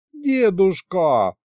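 high, drawn-out protest; indignant. A narrator's reading. Wife scolding grandfather, indignant. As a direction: protest high and drag out the word. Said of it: "возмутилась Варюша".

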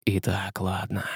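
low, very soft hiss; menacing hiss. A narrator's reading. Казимир pins Василий down, low menacing hiss. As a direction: hiss it quietly right into the face. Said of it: "зашипел Казимир ему в лицо".

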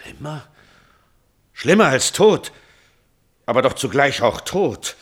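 hoch und laut vor Entsetzen; entsetzt. Acted. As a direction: schrei die Angst um die Tochter heraus. Vater entsetzt über die Nachricht. (entsetzt, hoch und laut)

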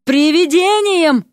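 high, drawn-out holler; acted frantic yell; bawling. A narrator's reading. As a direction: wail the threat out long and high. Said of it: "орала лягушка".

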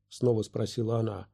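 ordinary delivery

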